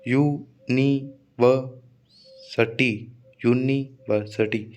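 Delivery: "यूनिवर्सिटी" as यू, नी, वर, सिटी with long pauses; split into syllables. Shown as a demonstration. Teacher counting syllables for students, "यूनिवर्सिटी" chopped into pieces. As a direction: break "यूनिवर्सिटी" into slow separate beats. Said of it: "पांच syllable हो गए".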